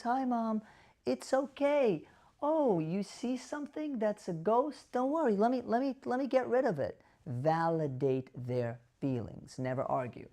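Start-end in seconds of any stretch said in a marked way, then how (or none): 0.0-7.0 gentle soothing voice to mom; soothing reassurance. Shown as a demonstration. Caregiver soothing a mother who sees a ghost. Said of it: "calm, soothing voice".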